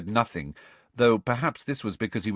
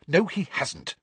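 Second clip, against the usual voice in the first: snapped; 9 semitones higher than usual. A narrator's reading. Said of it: "snapped Hermione".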